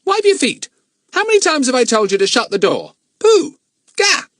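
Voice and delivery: very high, loud squawking parrot. A narrator's reading. The parrot Kiki bossing the librarian, high squawk. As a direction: screech it cheekily like a parrot.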